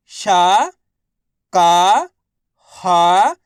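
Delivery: शा, का, हा each alone, loud and rising; letter-by-letter drill. Shown as a demonstration. Teacher for students to repeat, शा, का, हा drawn out singly. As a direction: sound out शा, का, हा, holding each. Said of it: "एक अक्षर का उच्चारण".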